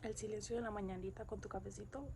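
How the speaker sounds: quiet reflective voiceover narration; hushed, wistful morning narration; soft voiceover. Acted.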